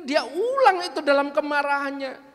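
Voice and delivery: usual speaking voice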